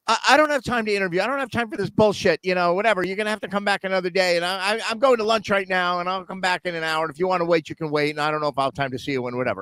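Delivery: high, fast, brushing him off; hammed-up impatient interviewer; dismissive interviewer. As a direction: rattle it off like a busy boss who can't be bothered. Dismissive interviewer voiced in story.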